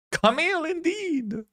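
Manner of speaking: high-pitched drawn-out name call; playful exclamation. Real, not acted. Reactor greeting Camille on screen, playfully exclaiming.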